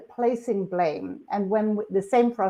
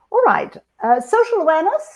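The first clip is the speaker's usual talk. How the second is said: excited announcement; 11 semitones higher, 8 dB louder than usual. Real, not acted. Launching new section.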